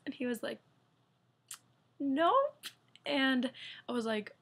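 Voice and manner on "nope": high, loud teasing nope; mock-coy nope; playful nope. Husband reveals high score.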